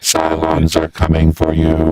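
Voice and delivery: deep, slow robotic vocoder; robotic Cylon drone, slow and deep. Shown as a demonstration. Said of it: "This is a Cylon".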